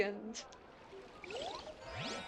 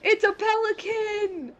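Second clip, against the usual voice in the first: excited; 11 semitones higher, 4 dB louder, slower than usual. Real, not acted. Spotting a pelican in game.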